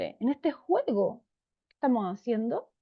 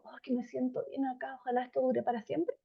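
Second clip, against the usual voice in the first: warm contentment; 8 dB quieter than usual. Retold or imitated. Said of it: "Eso lo provoca el tono cálido".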